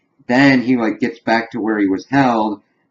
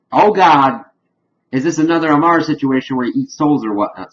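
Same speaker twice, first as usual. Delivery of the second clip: higher, louder "oh no, not this" reaction; mock dismay; 5 semitones higher, 4 dB louder than usual. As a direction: exclaim it in playful alarm, pitched up. Voicing an alarmed reaction to scene.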